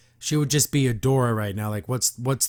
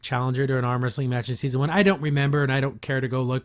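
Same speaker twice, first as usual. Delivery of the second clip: normal talking voice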